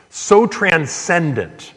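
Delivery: so transcendent stretched slow and lifted; drawn-out emphasis. Acted. Preacher savoring how God's whisper draws him out, drawn slow. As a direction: lean on so transcendent, slowly. Preacher lingers on key word.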